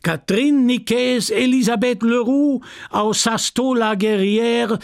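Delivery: high, ringing official announcement; chairman proclaiming an award. Acted. Chairman awards a medal for long farm service, proclaiming. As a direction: proclaim it ceremonially to the crowd.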